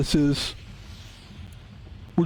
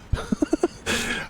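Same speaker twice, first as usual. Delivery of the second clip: airy laugh; 12 semitones higher, 3 dB quieter than usual. Real, not acted. Laughs after the voice.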